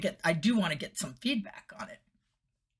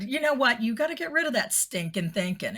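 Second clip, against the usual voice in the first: higher, emphatic pep talk; Stuart Smalley; 7 semitones higher, 4 dB louder than usual. Retold or imitated. Telling a coworker who was beating herself up, higher earnest urging. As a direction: urge it brightly, a hint of self-help guru. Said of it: "I sound like Stuart Smalley".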